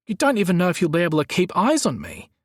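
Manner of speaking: high-pitched protest. A narrator's reading. Gazza argues against being followed inside, protesting. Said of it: "he protested".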